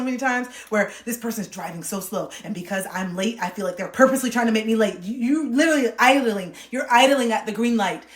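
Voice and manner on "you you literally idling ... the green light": loud shout at the idling car, rising to a yell on Go; retold road rage yell. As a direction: yell at a driver stuck on green. Yelling at slow driver.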